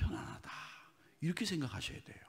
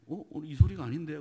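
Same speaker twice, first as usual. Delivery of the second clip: surprised; 4 semitones higher, 10 dB louder than usual. Retold or imitated. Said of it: "하고 이렇게 쳐다보면".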